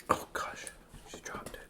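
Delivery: soft aside whisper. Real, not acted. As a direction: breathe it under your breath. Parent whispers aside off camera.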